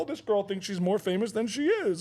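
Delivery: performed needling jeer; mocking call-out. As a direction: announce it teasingly to everyone around. Mocking a camera-shy passer-by.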